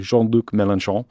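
"Mélenchon" said the French way, nasal final vowel, rising; a quick stab at a French accent on "Mélenchon". Shown as a demonstration. News host tries the French name, then apologises: light French accent. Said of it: "Apologies for that accent".

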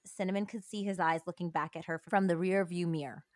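usual speaking voice

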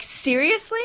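imitated indignation, pitched high; indignant question. Retelling a passenger's complaint about the child, high indignant question. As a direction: ask it high, in mock disbelief. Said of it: "she was like".

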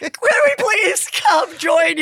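high, slow whiny plea; mock pleading. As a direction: beg to tag along in a put-on voice.

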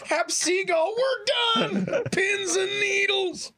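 high, slow, drawn-out pins and needles; movie quote. Retold or imitated. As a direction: stretch it out in awe. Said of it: "That felt like what you just did".

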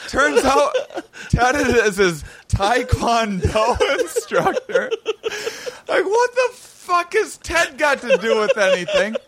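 high, slow, laughing through it; giggling at the absurdity; laughing while telling. Real, not acted. Laughing while retelling Ted bit.

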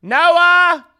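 loud high call into the room. Pretend. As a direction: call out as if searching. Calling out for a Noah.

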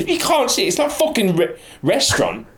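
high, exasperated outburst; indignant rant. Real, not acted. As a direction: vent at the cheeky team. Ranting about peloton etiquette.

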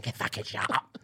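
low, hushed angry undertone; muttered retort. Retold or imitated. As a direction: mutter it back under your breath. Muttered back-and-forth argument retold.

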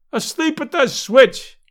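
high, loud, slow jeering yell; delighted yell. A narrator's reading. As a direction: yell it out gleefully at the batter. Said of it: "yelled the delighted fan".

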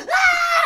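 loud, very high shriek; acted-out shriek of panic; mock terrified scream. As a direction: scream while fleeing a pursuer. She screams while fleeing.

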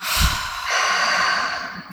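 long slow exhale; steadying breath. Real, not acted. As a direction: let out a deep breath. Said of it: "So deep breath".

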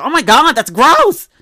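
loud, high disgusted outburst. Retold or imitated. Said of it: "absolutely disgusted".

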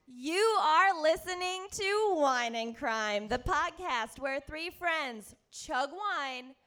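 loud, punchy show opener; podcast intro. Acted. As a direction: announce the show to the crowd.

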